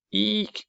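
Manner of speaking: high shrill little owl screech; tiny owl's squeaky screech; owlet screech. A narrator's reading. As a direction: screech like a baby owl trying to sound grown-up. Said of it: "he screeched".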